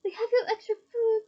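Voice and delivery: high, cheery elf squeak; a helpful elf offering food. A narrator's reading. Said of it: "squeaked the elf".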